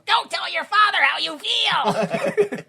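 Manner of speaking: high, very slow, hammed-up delivery; hammed-up line. Shown as a demonstration. Repeating the movie line, high and hammed-up. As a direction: stretch it out like a melodramatic actor.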